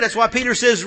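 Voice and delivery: usual speaking voice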